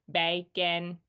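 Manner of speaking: bacon in two separate beats, "bay, kin"; robot syllable voice. Shown as a demonstration. Teacher splitting bacon into syllables, robotic "bay, kin". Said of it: "if I say this like a robot".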